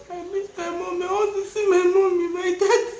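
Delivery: high, tearful wail retelling missing her parents; retold crying. Wife telling husband she misses her parents, tearful wail. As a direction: replay the homesick sob, half laughing. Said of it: "I say".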